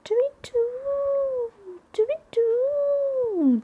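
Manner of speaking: high, soft hooting owl call. Shown as a demonstration. Showing children the owl's night call, high hoot. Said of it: "what sound does an owl make".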